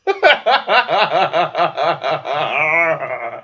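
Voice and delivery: high, loud cracking-up laugh; real laughter; burst of laughter. Real, not acted. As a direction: break down laughing. Laughs at own line.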